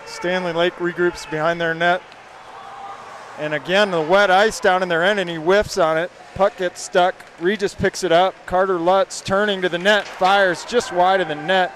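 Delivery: brisk live hockey call; play-by-play. Acted. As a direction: call the action as it happens.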